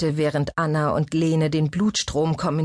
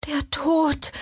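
high-pitched frightened whimper; 11 semitones higher than usual. A narrator's reading. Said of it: "wimmerte Lene".

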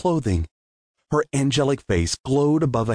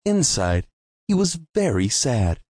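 ordinary delivery

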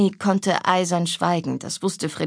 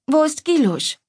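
curious question; 9 semitones higher, 3 dB louder than usual. Acted. Big sister asks after the other.

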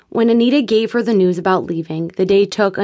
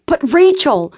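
protesting; 8 semitones higher, 5 dB louder, slower than usual. A narrator's reading. Anita protests.

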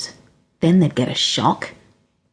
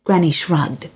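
usual speaking voice

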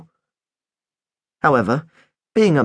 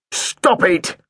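snapping; 5 semitones higher than usual. Acted. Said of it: "snapped Mister Deadman".

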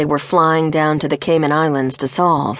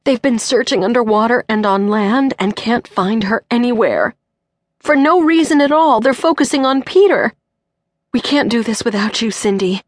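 choked voice; 5 semitones higher than usual. Acted. Mother pleading with Cindy, voice choking. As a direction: hold back tears while pleading. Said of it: "Dana's voice choked back".

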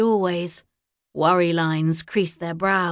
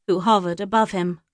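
usual speaking voice

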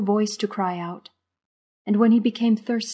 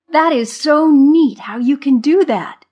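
high, loud, drawn-out admiration; delighted squeal; 8 semitones higher, 10 dB louder than usual. A narrator's reading. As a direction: squeal with delight, stretching the words slowly. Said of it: "he squealed".

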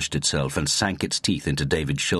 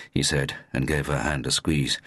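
usual speaking voice

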